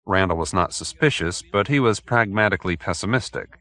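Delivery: usual speaking voice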